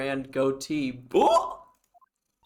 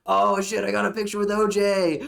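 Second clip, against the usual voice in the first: mock excited fan; 6 semitones higher, 8 dB louder than usual. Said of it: "were like".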